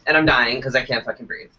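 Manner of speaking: ordinary talk, nothing marked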